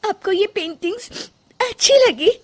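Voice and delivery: high, sniffly, hopeful question; tearful surprise. Acted. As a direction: ask in disbelief through tears. Tearful wife asks, disbelieving.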